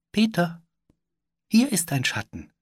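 very high, tinkling fairy voice; high fairy call; tiny bell-like fairy. A narrator's reading. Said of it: "ihre Stimme klang wie feines Geläut".

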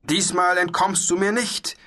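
high-pitched menacing shout; bellowing. A narrator's reading. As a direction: call out a knight's threat to his prey. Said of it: "brüllte der weiße Ritter".

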